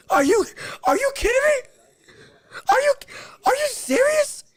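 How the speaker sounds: stunned disbelief with a half laugh; incredulous disbelief. Real, not acted. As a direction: react like the judges have lost their minds. Outraged at a lopsided scorecard.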